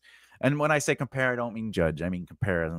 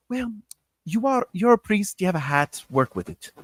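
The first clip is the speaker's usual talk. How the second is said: affected director's voice, high start falling away; lightly put-on directing voice; imitated director; 8 semitones higher than usual. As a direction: hand down the note like a mannered stage director. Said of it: "but he would talk like this".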